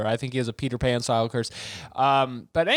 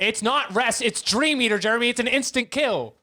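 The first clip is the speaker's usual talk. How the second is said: insistent; 9 semitones higher than usual. Real, not acted. Heated argument over Pokemon moves.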